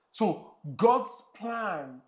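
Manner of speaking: slow, stretched stress on God's; drawn-out emphasis on God's; emphatic. Real, not acted. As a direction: lean hard on God's plans. Preacher stressing God's plans.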